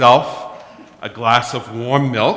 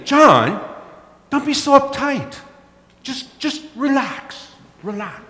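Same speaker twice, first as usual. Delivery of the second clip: higher, mock soothing wife voice; wife calming; 14 semitones higher than usual. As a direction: imitate his wife telling him to relax. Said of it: "Irene said to me".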